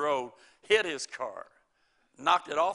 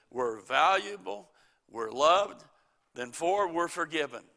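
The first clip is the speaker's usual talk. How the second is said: ordinary talk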